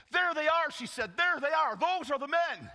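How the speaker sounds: high, loud, eager; girl spotting the preachers in the crowd; pointing out. Acted. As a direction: point them out proudly. Girl pointing out Paul and Silas.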